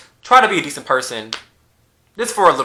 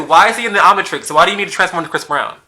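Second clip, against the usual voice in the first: ordinary talk, nothing marked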